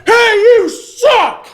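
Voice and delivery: high, drawn-out jeering shout; mock heckling yell. Friend imagines heckling the driver, drawn-out high mock shout. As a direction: heckle slowly and loudly, half laughing.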